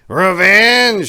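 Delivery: high, loud shriek; old man's shriek. A narrator's reading. Old man stuck on the floor, high shriek. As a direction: shriek it from flat on his back. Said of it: "shrieked old Apricot".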